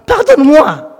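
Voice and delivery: pleading. Retold or imitated. Coworker begging forgiveness.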